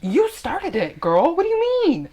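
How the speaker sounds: sassy accusation leaping up high at the end; mock indignant comeback. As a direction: throw the blame back, mock outraged. Acting out mock quarrel with mom.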